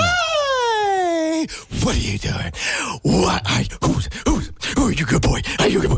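put-on high excited baby talk to a dog; greeting the dog. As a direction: coo over the dog coming home. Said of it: "how do you talk to Odin".

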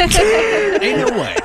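high, slow, drawn-out disbelief; incredulous. Acted. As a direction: stretch it out like you can't believe it. Mock disbelief at his pick.

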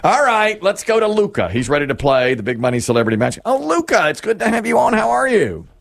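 high, bright-paced host patter; cheery game show host voice greeting the contestant. Acted.

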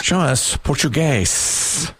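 slow, drawn-out board call; game-show reveal call. Pretend. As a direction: stretch each word for the reveal. Host calls answer to board.

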